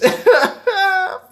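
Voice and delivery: high squealing laugh. Real, not acted. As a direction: burst into laughter. Laugh after peace.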